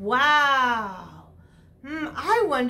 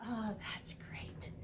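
whisper; 5 semitones lower, 21 dB quieter than usual. Real, not acted. Whispered aside after the chant.

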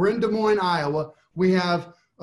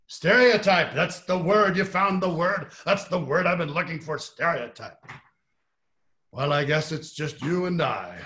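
lightly voiced Jerry Spence, seizing the word. Lawyer praising the juror's answer, lightly voiced Jerry Spence. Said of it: "I'll do my Jerry Spence impression".

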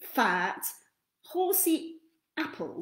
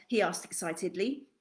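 plain reading voice

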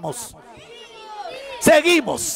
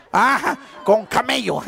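ordinary talk

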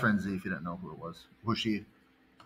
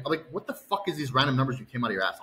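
ordinary delivery

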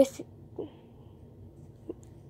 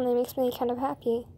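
ordinary delivery

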